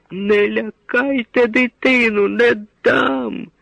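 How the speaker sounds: very high, slow, anguished plea; desperate cry. A narrator's reading. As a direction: throw yourself between them, crying out. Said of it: "метнулася до Лук'яна Марина". (мати відчайдушно захищає дитину)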